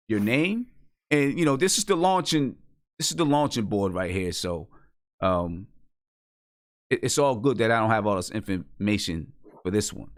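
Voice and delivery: ordinary delivery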